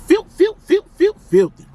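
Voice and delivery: rising hype on filth; excited shout. Acted. Hyped food review catchphrase.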